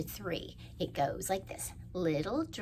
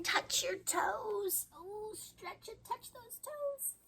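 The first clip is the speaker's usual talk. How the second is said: high playful; much slower than usual. Acted. Leading kids' stretch action.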